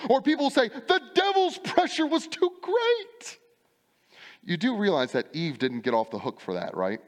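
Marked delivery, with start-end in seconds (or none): 0.7-3.4 high, slow mock whine blaming the devil; whiny excuse. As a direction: drag it out pitifully, pitched up. Excuse voiced by preacher.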